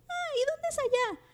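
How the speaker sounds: voz de niñita de títere mucho más aguda; voz de niña. Retold or imitated. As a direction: pregunta con vocecita de niña inocente, muy lento al final. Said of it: "animen esta voz". (vocecita aguda de niña de Plaza Sésamo)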